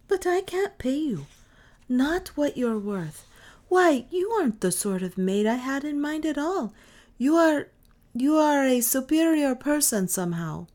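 a kind woman, a little awed; wondering mother. A narrator's reading. Mother telling the strange girl she is superior, wondering.

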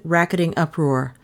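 ordinary delivery

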